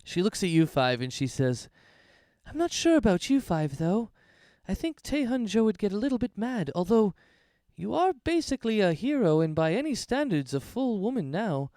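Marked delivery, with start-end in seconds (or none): 2.5-11.7 higher innkeeper voice, teasing and secretive; higher voice, playful and hushed at the end; Errol the conspiratorial innkeeper. Acted. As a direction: offer the drink like a shared secret.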